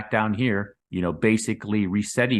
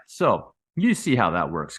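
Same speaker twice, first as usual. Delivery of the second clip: ordinary talk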